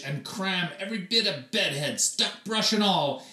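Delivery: ordinary delivery, nothing marked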